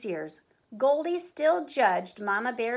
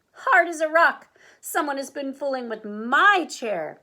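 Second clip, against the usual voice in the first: higher, louder Mama Bear dismay; shocked gasp; 4 semitones higher, 7 dB louder than usual. A narrator's reading. Mama Bear tries her chair, shocked gasp. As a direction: exclaim in shock. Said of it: "Mama Bear tried hers and gasped".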